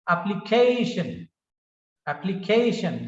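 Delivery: application with CA punched and AP lighter; primary and secondary stress. Shown as a demonstration. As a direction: say appli-CA-tion, main weight on CA. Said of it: "first stress and this is second stress".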